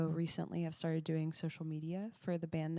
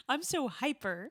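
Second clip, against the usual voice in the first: put-on hyper teen voice; 6 semitones higher than usual. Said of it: "My favorite that was in that was".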